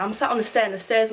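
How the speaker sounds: usual speaking voice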